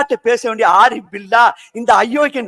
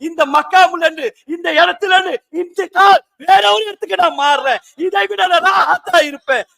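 raised sermon voice, pressing onward; fervent preaching; 5 semitones higher than usual. Real, not acted. Preacher praising those who long to meet God, fervently. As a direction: drive the point home to the congregation.

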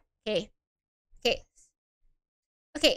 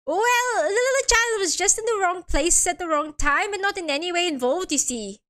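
lilting fake Irish, starting higher; poor Irish accent; 4 semitones higher than usual. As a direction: attempt an Irish defendant explaining. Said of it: "doesn't sound Irish at all".

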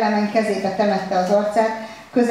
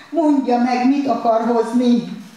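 louder, higher, slow desperate shout; anguished shouted demand; desperate cry; 4 semitones higher, 4 dB louder, slower than usual. A narrator's reading. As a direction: cry out at the waiter. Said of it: "kiáltotta esente Kelemen".

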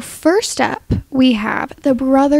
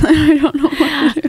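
laughing through a mouthful of ice; 3 semitones higher than usual. Real, not acted. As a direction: mouth full of ice, giggling. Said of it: "I have ice in my mouth".

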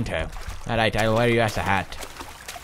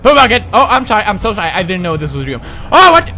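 high shrieking panic at the bed; panicked screaming; 12 semitones higher, 11 dB louder than usual. Acted. Gamer shrieking at a haunted bed, panicked screams. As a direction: scream in terror at a moving bed.

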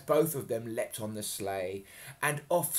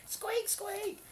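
very high squeak squeak; a tiny squeaking teddy; squeaky toy sound. Acted. Teddy gets trodden on, very high squeak.

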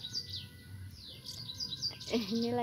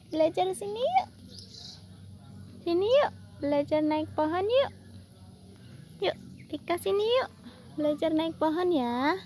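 higher sing-song coaxing with rising yuk; coaxing pet talk; 8 semitones higher, 3 dB louder than usual. Acted. Urging her squirrels to climb the tree, higher sing-song coax. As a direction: coax them up like a fond owner.

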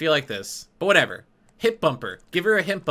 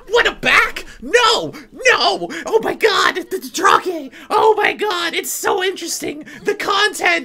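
very high, loud shrieking; sarcastic, over-the-top screaming frenzy; mock hysteria; 4 dB louder, slower than usual. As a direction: wail like an overwhelmed fan. Mock hysterical fan reaction again.

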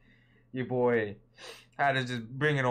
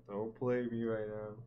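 normal talking voice

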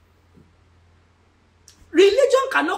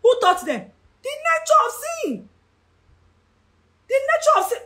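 exclaiming in dismay; 10 semitones higher than usual. Real, not acted. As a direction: cry it out high, stretching the last word. Preacher cries out the point.

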